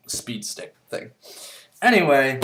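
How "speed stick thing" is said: whispered aside. Real, not acted. Deadpan sponsor bit aside.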